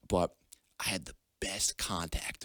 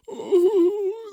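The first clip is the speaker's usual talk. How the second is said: very high, loud imitated bawl; mock crying wail; 11 dB louder than usual. Kid found sobbing at home, exaggerated mock wail. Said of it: "Crying his eyes out".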